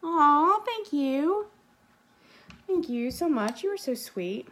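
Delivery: high, bright gushing thanks; delighted thanks. Real, not acted. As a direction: gush over the compliment. Thanking a viewer for compliment.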